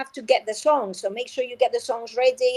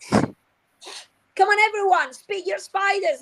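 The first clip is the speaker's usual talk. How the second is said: much higher, lively rallying call; excited teacher; 8 semitones higher, slower than usual. Shown as a demonstration. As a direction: urge the class, high and bright. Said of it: "made-up story".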